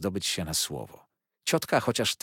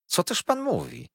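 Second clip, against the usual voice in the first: much higher, startled cry; frank exclamation; 12 semitones higher, 3 dB louder than usual. A narrator's reading. The aunt exclaims at Micawber's gloomy words, high and startled. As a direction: blurt it out in honest surprise. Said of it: "zawołała ze zwykłą sobie szczerością".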